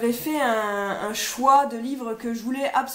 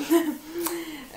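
short laugh; 4 semitones higher than usual. Real, not acted. Laughs at own mistake.

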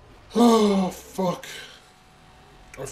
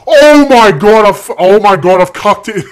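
anguished shout; 6 semitones higher, 19 dB louder than usual. Real, not acted. Gamer howls after crashing in the final seconds. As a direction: scream it out as the run collapses.

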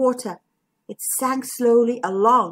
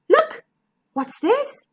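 surprised; 13 semitones higher, slower than usual. Acted. Zigbee discovers bottle.

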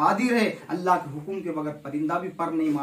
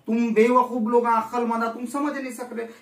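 loud, raised scolding of fools; 5 semitones higher, 5 dB louder than usual. Real, not acted. Preacher rebuking foolish listeners, loud scolding. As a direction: berate the crowd, voice up and loud.

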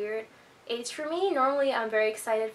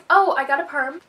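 excited; 4 semitones higher, 9 dB louder than usual. Real, not acted. Suddenly remembers news.

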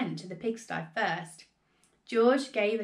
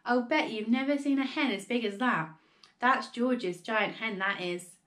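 ordinary delivery, nothing marked

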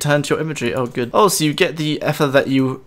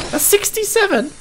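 very high, excited call of the number; amazed exclamation. Real, not acted. Gamer seeing sixty-seven damage, very high excited call. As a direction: squeal the number out. Big hit on Vet'ion.

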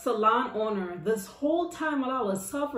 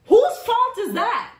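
indignant retort; 10 semitones higher, 6 dB louder than usual. Retold or imitated. Teller retorting at the stylist, high and laughing. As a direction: fire it back high and incredulous.

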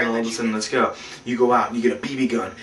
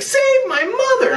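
very high, drawn-out wail; mock desperate plea; slower than usual. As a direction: beg shrilly in a put-on panic. Butch begs for help.

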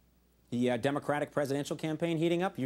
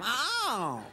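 high, drawn-out "oh"; mock cry of discovery; 8 semitones higher than usual. She finds the ham, high exaggerated cry. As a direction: wail a long, high "oh" as an impression. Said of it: "I like my little impressions".

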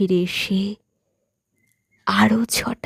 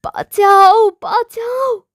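high-pitched cry for help, falling away; a drawn-out, high plea to be saved; screaming for help; 11 semitones higher, 4 dB louder, slower than usual. A narrator's reading. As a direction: cry out desperately, voice leaping up high. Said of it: "আর চিৎকার করছে".

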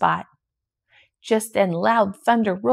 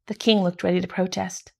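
ordinary delivery, nothing marked

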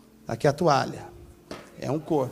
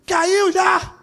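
hammed-up screaming father, much higher and louder; mock furious father; 10 semitones higher, 5 dB louder than usual. Pastor mocks an angry dad scolding his fallen child, shouting high. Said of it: "Aí o papai chega e fala".

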